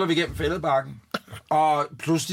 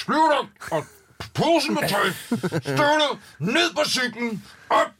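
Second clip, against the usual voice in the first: high, strained bursts; word-by-word exasperated heaving; furious, retold; 10 semitones higher than usual. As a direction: act out angrily grabbing each thing. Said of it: "Så bliver jeg så rasende".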